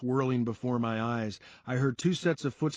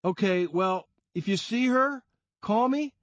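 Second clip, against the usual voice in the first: put-on higher, louder young guy asking; Sean asking; 9 semitones higher, 5 dB louder than usual. A narrator's reading. Sean asking the bar crowd to call him, higher voice. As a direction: ask it lightly of the group. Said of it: "Sean asked".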